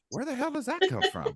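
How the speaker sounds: high, fast mock disbelief with a laugh; squeaky amazed quote, half laughing. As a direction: play up the shock, laughing. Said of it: "I'm going".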